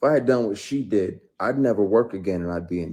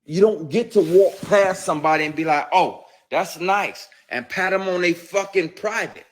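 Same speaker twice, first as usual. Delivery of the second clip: high, loud, incensed reprimand; outraged scolding; 10 semitones higher, 7 dB louder than usual. Real, not acted. Man condemning unwanted groping, outraged. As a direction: lay down the rule in raised-voice outrage.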